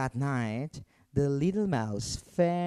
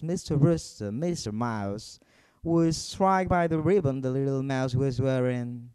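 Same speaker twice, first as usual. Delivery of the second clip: usual speaking voice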